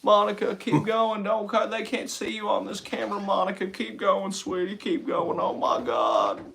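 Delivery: soft Southern coaxing voice; Bill Clinton. Acted. Impressionist as Clinton coaxing Monica, soft Southern murmur. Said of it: "You gotta have Bill Clinton".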